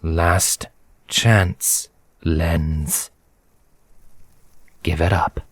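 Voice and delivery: low menacing villain. Acted. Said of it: "his eyes narrowing".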